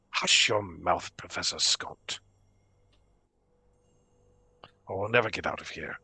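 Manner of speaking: low, hushed orb voice; talking orb. Acted. Trapped orb hushing its finder, in a low soft voice. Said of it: "as you grab the orb, it says".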